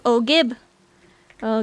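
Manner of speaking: ordinary talk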